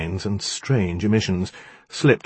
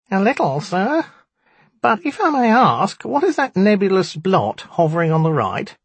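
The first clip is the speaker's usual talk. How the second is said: high, squeaky little imp; squeaky nasal voice; 12 semitones higher, 4 dB louder than usual. A narrator's reading. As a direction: squeak it out through the nose. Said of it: "squeaky nasal voice".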